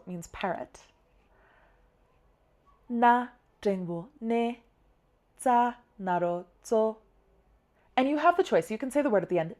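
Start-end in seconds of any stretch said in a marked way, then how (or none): 2.9-7.0 "Na, jengbu, ne", pause, "za, naro, zo"; slow recital of "Na, jengbu, ne, za, naro, zo" in two groups; syllables spelled separately. Shown as a demonstration. Teacher spelling the word for parrot, syllables kept separate. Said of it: "I put them separately".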